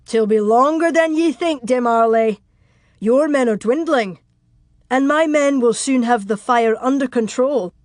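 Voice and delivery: defiant battlement shout in character. Acted. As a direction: call the challenge back down to the enemy. Defiant answer shouted from burning castle.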